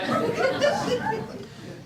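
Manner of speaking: high burst of laughter; bright laughing burst. Real, not acted. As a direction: laugh at own joke. Laughs after joke.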